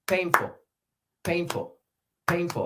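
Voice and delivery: "Painful" starting high on PAIN then falling; stress on PAIN. Shown as a demonstration. Teacher drilling the stress pattern of "painful". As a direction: hit PAIN, then let "ful" drop. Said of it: "start on three and then go down".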